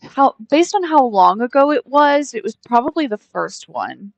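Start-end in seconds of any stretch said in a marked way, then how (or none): none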